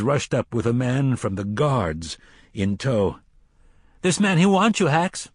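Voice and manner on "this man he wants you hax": high, loud call to the cook; urgent summons. Acted. Scullery boy summons the cook.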